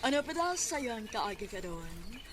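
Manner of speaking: ordinary talk, nothing marked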